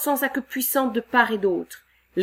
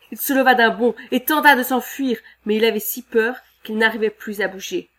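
ordinary delivery

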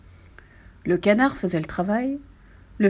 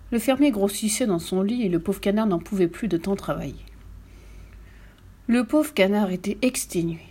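ordinary delivery

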